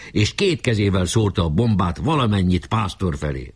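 usual speaking voice

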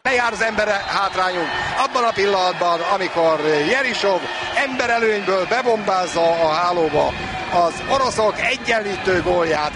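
usual speaking voice

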